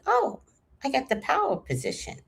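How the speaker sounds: high-pitched, softening aha; retold wide-eyed discovery; dawning realization. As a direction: realize you hold the upper hand. Sudden realization of leverage.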